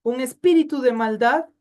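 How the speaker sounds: usual speaking voice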